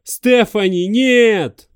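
loud high horrified cry; anguished scream. Acted. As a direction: scream in horror as she falls. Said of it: "страшно закричал он".

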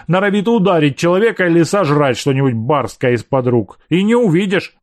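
scolding housekeeper. Acted. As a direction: scold him like a riled-up housekeeper. Angry housekeeper scolds him.

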